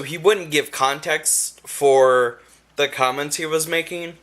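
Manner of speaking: ordinary talk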